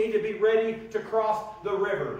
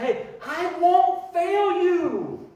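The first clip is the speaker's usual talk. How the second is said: slow, earnest promise; 8 semitones higher, 3 dB louder, much slower than usual. Acted. God's promise voiced.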